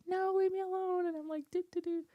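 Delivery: high, whimpering plea; whiny crying kid. Retold or imitated. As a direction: whimper like a cornered little kid. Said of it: "whining and crying".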